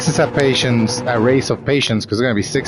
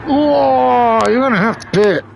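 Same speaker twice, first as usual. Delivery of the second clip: high and louder, breaking off mid-thought; startled outburst; 13 semitones higher, 4 dB louder than usual. Real, not acted. Streamer explaining pit stops breaks off in alarm, high startled voice. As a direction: jump in alarm at the screen.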